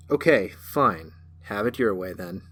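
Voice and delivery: slow, unbothered, fading to a mutter; dismissive cat. A narrator's reading. Cat shrugs off threat.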